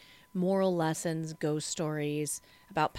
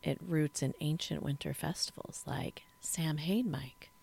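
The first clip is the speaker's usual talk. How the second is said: whispering; 3 semitones lower, 6 dB quieter than usual. Acted. Spooky hushed aside.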